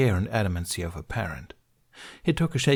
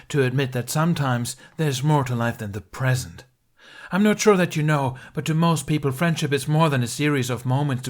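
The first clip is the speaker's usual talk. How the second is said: loud, higher scornful lecture; venomous lecture; 5 semitones higher, 4 dB louder than usual. A narrator's reading. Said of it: "The venom in his words".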